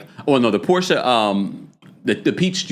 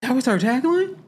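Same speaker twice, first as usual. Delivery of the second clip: incredulous; 13 semitones higher than usual. Real, not acted. Disbelief again.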